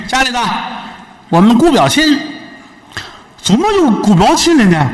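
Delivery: put-on high, coy young wife calling her husband. Wife calling her husband about the guest, high and coy. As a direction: sing out to him like a sly young wife.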